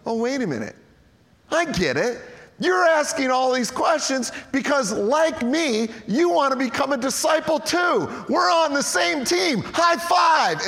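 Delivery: gleeful realization. Acted. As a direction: build from discovery to delight. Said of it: "all of a sudden he goes".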